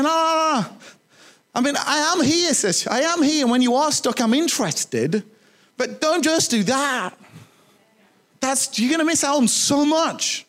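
high-pitched, earnest protest as Jesus; acted high-voiced objection, stretched at the end; pleading Jesus. Jesus objects to being a genie.

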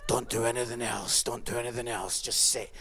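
low, monotone mock voice; mocked YouTube addict. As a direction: drone on like someone who only watches clips. Mocks audience who watch every clip.

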